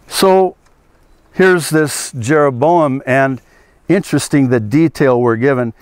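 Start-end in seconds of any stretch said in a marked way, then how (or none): none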